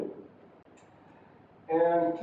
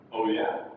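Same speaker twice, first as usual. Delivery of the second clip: "Oh yeah?" starting low, rising on YEAH; rising intonation; 6 semitones lower than usual. Shown as a demonstration. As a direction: begin "Oh yeah?" low and lift it. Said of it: "That's rising intonation".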